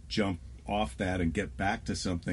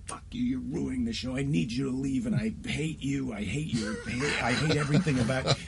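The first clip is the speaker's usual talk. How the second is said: mock genuine anger; 5 semitones higher than usual. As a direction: play at losing it with a heckler, breaking toward a laugh. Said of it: "if you're genuinely angry and you're like".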